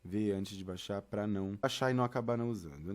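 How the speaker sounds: ordinary talk, nothing marked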